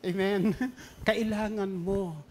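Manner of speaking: ordinary talk, nothing marked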